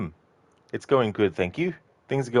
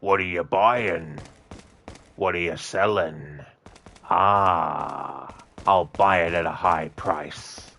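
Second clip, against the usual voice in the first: slow, drawn-out shopkeeper voice; Merchant; slower than usual. Retold or imitated. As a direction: play the shady game merchant, slowly. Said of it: "the original Merchant voice actor".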